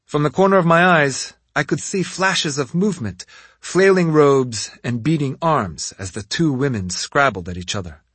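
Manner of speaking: ordinary delivery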